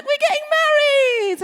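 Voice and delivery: very high, loud hammed-up cry; mock insistent shout. Margaret insisting to her baffled assistant, shrieked high and loud. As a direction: shout it insistently over the objection.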